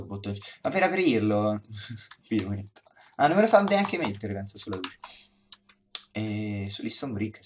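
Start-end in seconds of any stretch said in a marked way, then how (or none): 3.2-4.3 sudden high exasperated cry; surprised outburst. Real, not acted. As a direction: burst out in frustrated surprise. Block won't place, exasperated.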